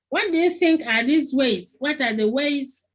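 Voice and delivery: ordinary talk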